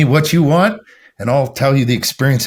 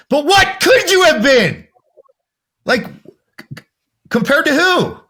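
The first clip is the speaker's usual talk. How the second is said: much higher, hammered rapid-fire questions; pushing them hard, pitched way up; intense challenge; 5 semitones higher than usual. Retold or imitated. Coach challenging a doubter about his potential, much higher and insistent. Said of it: "I'm like".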